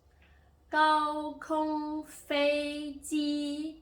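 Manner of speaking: 高空飞机 chanted slowly, high and level, loud; first tone. Shown as a demonstration. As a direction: hold each syllable of 高空飞机 long and flat up high. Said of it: "symbol of the first tone".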